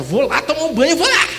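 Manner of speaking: high, loud excited shout; excited calling out. Retold or imitated. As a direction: call out to your wife, rising in excitement. Acts out excited call to wife.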